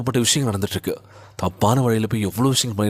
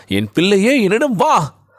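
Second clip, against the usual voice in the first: high, raised declaiming call; preacher voicing God's call; 9 semitones higher, 5 dB louder, slower than usual. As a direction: summon a child with authority. Voices God calling his child.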